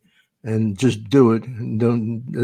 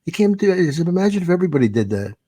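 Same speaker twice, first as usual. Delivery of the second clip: higher-pitched mock reprimand; retold scolding; 6 semitones higher, faster than usual. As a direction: act out telling off a young comic. Retold scolding of a joke thief.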